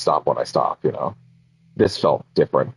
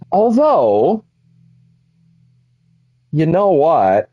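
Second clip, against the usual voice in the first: jumps way up in pitch and louder; an idea bursts out mid-sentence; sudden high exclamation; 11 semitones higher, 4 dB louder, much slower than usual. Real, not acted. Streamer interrupting himself with a sudden idea, high and loud.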